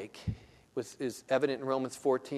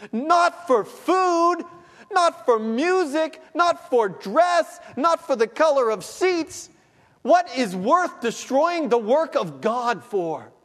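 much higher, loud, very slow and hammering; climactic preaching cry; 10 semitones higher, 7 dB louder, slower than usual. Acted. Pastor crying out to congregation, loud climactic proclamation. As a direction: pound each Not home.

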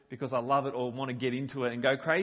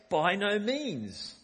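By no means drawn out slowly, means falling hard; slow emphatic denial; 6 semitones higher, much slower than usual. Retold or imitated. Preacher quoting Paul's firm, slow denial in verse fifteen. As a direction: lean on every word of By no means. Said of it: "He's emphasizing it".

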